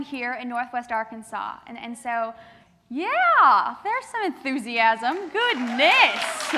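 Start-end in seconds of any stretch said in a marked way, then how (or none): 2.9-6.5 excited reaction. Real, not acted. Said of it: "reacting to the crowd's cheers".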